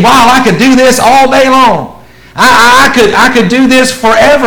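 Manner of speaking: high, loud thrilled gush; awed excitement. Retold or imitated. Preacher voicing the man thrilled by Jesus' teaching. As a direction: gush like a starry-eyed fan. Awed follower voiced in sermon.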